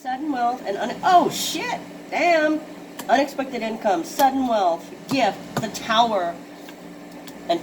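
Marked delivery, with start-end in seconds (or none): 1.0-2.6 surprised outburst. Real, not acted. Reader startled by the card, surprised curse. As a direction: blurt it out as the card lands.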